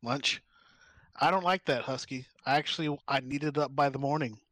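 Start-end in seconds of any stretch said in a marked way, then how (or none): none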